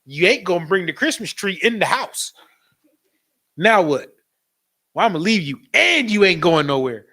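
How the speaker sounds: loud mock tough talk; mock husband standing up to his wife, loudly; defiant man. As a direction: lay down the law. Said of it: "Men ... be like".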